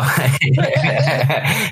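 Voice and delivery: real chuckle after a remark; easy, genuine chuckling; self-amused laugh. Real, not acted. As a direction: laugh at your own admission. Laughs about having a proper job.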